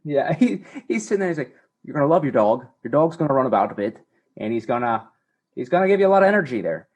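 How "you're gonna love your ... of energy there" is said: hammed-up mixed Australian accent, fast; mock Australian accent. Man mimics Australian dog trainer, jumbled Australian accent. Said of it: "I don't know what four accents I just combined".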